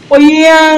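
loud, high imitated boom; cannon blast sound. Gamer voicing his cannon fire, loud mock boom. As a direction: blast it out like a big gun firing.

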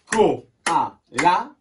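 loud "Koala" in three beats, rising on the last; syllable by syllable. Shown as a demonstration. Teacher has pupils count the claps, syllable by syllable. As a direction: chop "Koala" into Ko, a, la, lifting at the end. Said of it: "J'ai tapé combien de fois?".

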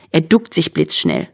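usual speaking voice

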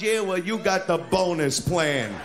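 ordinary talk, nothing marked

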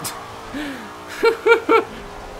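high giggle at his own joke; laughing. Real, not acted. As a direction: break into high-pitched giggles. Amused at own impression.

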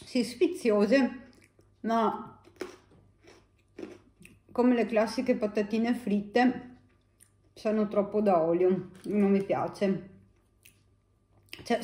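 chewing while talking; eating between words; mouth full of fries. Real, not acted. Seen 1: Bites a fry at 1.5-1.9, then talks while chewing.

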